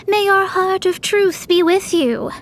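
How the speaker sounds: putting on airs. Retold or imitated. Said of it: "she put on this air".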